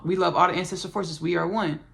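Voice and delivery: usual speaking voice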